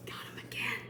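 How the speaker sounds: secretive whisper. A narrator's reading. Narrator confiding to children, whispered.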